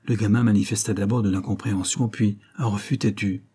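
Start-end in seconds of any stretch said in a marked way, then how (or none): none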